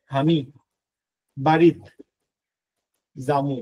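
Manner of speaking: slow word-by-word Bogura dialect. Shown as a demonstration. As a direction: dictate it in Bogura dialect, pausing between words. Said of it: "বগুড়ার ভাষা".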